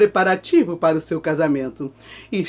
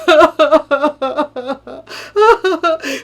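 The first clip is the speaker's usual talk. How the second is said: theatrical sobbing wail; 8 semitones higher, 5 dB louder than usual. Acted. After lament.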